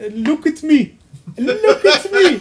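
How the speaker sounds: put-on high, drawn-out accented movie quote; exaggerated imitation of a partner's pirate voice; Lauren. Host imitating how partner quotes the film, high mock accent. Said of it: "sounds, Lauren says".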